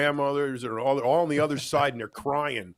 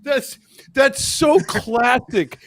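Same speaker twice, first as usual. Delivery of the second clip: laughing; 9 semitones higher, 7 dB louder, much slower than usual. Real, not acted. Amused reaction after the impression.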